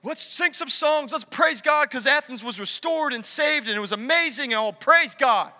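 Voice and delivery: high, celebratory worship-leader burst; hammed-up praise. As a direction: imitate a triumphant sermon close. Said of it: "I wanna be like".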